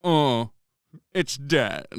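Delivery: lightly voiced Hutt remark; acted Hutt character. Player voicing Abba over a corpse, lightly put-on Hutt voice. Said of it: "before finally going".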